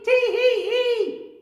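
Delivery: very high, loud, slow tee-hee giggle; monkey delighted with his trick; cheeky monkey giggle. Acted.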